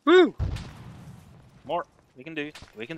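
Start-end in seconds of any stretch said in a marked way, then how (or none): 0.0-0.3 excited whoop. Real, not acted. Cheering in game.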